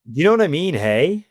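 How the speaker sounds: high rising tag hey, lightly imitated; West Coast hey. Imitating Vancouver youth ending sentences, light rising hey. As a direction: float the hey up like a Vancouver kid. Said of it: "They'd be like".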